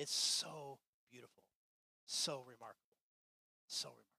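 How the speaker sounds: ordinary talk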